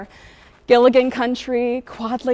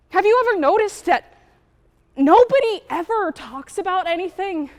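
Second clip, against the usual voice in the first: higher-pitched, exasperated complaint; exasperated venting; 6 semitones higher, 3 dB louder than usual. Acted. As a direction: vent with rising frustration. Venting about school and silence.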